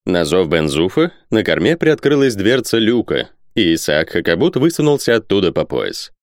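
plain reading voice

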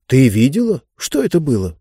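plain reading voice

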